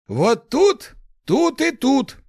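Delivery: very high, loud strained cry; Lars's muffled voice. A narrator's reading. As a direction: call out high and loud, as if from another room. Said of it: "раздался приглушённый голос Ларса". (громкий приглушённый крик)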